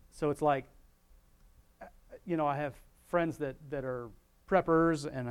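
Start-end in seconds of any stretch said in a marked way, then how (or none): none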